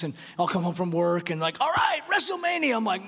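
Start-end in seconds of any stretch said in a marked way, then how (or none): 1.6-2.7 higher-pitched rallying call; playful hype. Acted. Dad home from work to his boys, playful hype. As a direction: kick off the play fight, pitch up.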